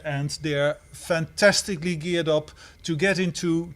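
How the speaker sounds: usual speaking voice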